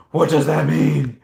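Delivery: drawn-out superhero growl; playful Batman interrogation. Pretend. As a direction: demand answers as the dark vigilante. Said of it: "Sorry, my Batman came in".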